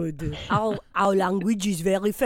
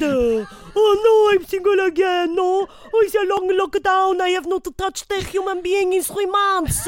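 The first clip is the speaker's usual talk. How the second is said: whiny mock complaint; 9 semitones higher, 4 dB louder than usual. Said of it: "With all her".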